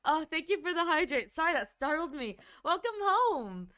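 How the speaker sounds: high, bright greeting; welcoming someone home. Real, not acted. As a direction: greet them brightly as they arrive. Greets arriving viewer warmly.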